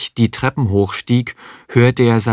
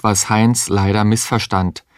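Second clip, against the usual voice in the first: ordinary delivery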